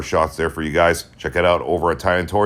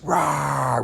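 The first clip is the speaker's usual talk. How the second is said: imitated yell; 11 semitones higher than usual. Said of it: "when he's yelling".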